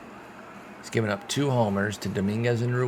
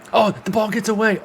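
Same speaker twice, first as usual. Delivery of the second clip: much higher and louder, caught off guard; surprised shout; 12 semitones higher, 7 dB louder than usual. Real, not acted. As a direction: burst out as the ball skips away. Wild pitch moves winning run.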